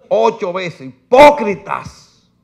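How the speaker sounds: slow, loud, high "hipócritas" flung out; loud rebuke. Acted. Preacher voicing Jesus' rebuke, loud and slow. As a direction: hammer each syllable of the accusation.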